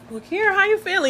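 high, caring boss imitation; imitating a kindly concerned caller; sweet concern. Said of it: "she's so sweet".

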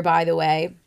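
ordinary talk

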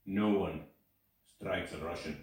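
flat, low, dead serious Russian guy; mock stone-faced delivery; deadpan. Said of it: "totally deadpan".

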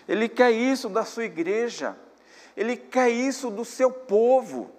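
loud, ringing sermon voice, stretched phrases; preaching at full force. Acted. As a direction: exhort the church, slowly and forcefully. Preacher closing his exhortation.

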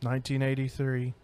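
low, grave memorial announcement; mock solemn. Host calls a moment of silence for a snack, mock solemn.

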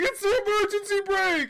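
very high, loud mock shouting; hammed-up high-pitched shout; imitated frantic yell. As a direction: yell it like a panicked bystander running over. Acting out boyfriend yelling as he runs.

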